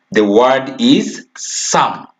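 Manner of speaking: slow, deliberate stress on "some"; slow emphasis. Shown as a demonstration. Teacher stressing "some" to the children, slowly. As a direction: spell out "some" slowly, word by word. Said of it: "I want to emphasize this word here".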